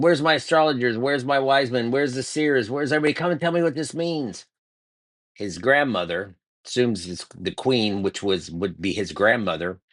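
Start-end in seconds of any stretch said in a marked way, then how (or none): 0.0-4.5 a king retold yelling for his wise men in a panic; retold panicked shout. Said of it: "He shouted".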